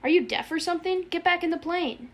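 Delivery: high-pitched exasperated plea; a touch of desperate yelping; beseeching yelp. A narrator's reading. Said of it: "he yelped beseechingly".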